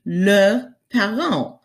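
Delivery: "leurs parents" slowly, s not pronounced; silent final s. Shown as a demonstration. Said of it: "you don't pronounce it".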